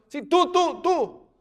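high, loud insistent relay; single someone out across a field; calling out. Acted. Acts bystanders pointing her out.

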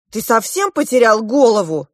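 high, loud scolding; lashing out. A narrator's reading. Girl rounding on Klop, lashing out. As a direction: go at him indignantly. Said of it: "накинулась я на Клопа".

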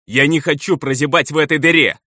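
high, strained outburst; breaking into shouting. A narrator's reading. Elder refuses to rot in this hole, bursting into shouts. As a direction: cry out in frustration. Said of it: "сорвался он на крик".

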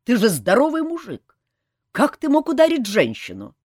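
loud, high-pitched reproach; indignant scolding. A narrator's reading. Colonel shames Kantor for hitting a woman, indignant. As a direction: scold with outraged force.

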